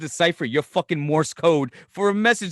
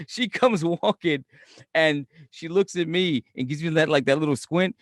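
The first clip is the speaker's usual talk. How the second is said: ordinary talk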